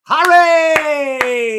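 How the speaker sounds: high, loud call of the name; excited shout. Real, not acted. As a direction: yell it as the kick lands. Calls out at a great kick.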